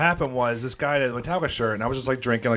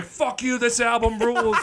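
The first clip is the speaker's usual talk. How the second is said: mock yell; 12 semitones higher, slower than usual. Yelling while blasting Fuel.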